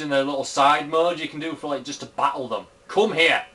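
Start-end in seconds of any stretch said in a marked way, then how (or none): 2.9-3.4 louder, sharp call to the monster. Real, not acted. Gamer chasing a monster in battle, loud call. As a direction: yell at it to come.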